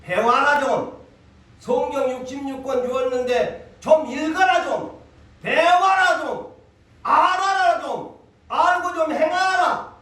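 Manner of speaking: building from firm to loud, high, slow pleading; God pleading. Retold or imitated. A pastor voices God urging believers to read the Bible, building to a loud plea. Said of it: "하나님이 여러분들을 성경 육십육 권을 두고".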